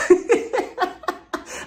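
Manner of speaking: airy squeaky laugh high up; breathy high laugh. Real, not acted. Vlogger cracking up at Kenya's exit, a high airless giggle. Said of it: "like a bad bitch".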